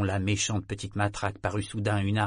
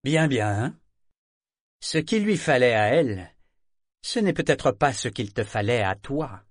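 sly, louder, creeping menace; insidious; 5 dB louder than usual. A narrator's reading. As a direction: slide it in with a veiled threat. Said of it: "d'un ton insidieux".

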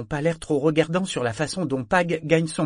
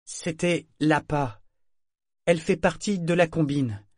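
lent, chaque syllabe détachée, plus aigu; en articulant soigneusement; 7 semitones higher than usual. A narrator's reading. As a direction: articule mot à mot, posément. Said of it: "en articulant soigneusement". (Althea explique aux marins, en articulant lentement)

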